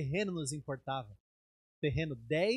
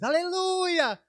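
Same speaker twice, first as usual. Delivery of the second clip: high, loud joyful cry; church shouting hallelujah; 14 semitones higher, 7 dB louder, much slower than usual. Retold or imitated. Church cheering the pastor's news with a loud shout. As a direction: shout it out like a whole congregation. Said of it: "A igreja fez".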